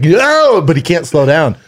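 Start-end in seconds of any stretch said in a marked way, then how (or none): 0.0-0.6 much higher, louder yelled no; mock cry of panic. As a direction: yell it as the scooter won't stop. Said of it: "And he's like".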